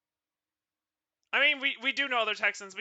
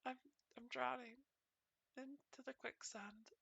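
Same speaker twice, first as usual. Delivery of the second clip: much softer, slow fading voice; mock sinking; 3 semitones higher, 19 dB quieter, slower than usual. Avatar pretending to drown in quicksand, fading out. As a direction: let it sink away into nothing.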